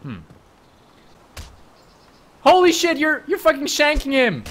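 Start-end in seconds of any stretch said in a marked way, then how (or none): 2.4-4.4 much higher, louder yell of disbelief; shocked shout. Real, not acted. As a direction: scream it, you can't believe what you see. Teammate is stabbing someone.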